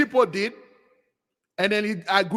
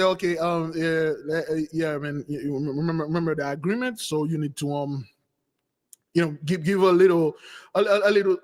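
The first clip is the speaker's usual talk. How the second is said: hesitant reminder; 4 semitones lower than usual. Retold or imitated. Balaam reminding the victor of the agreement, hesitant and halting. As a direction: tread carefully, stumbling over a polite reminder.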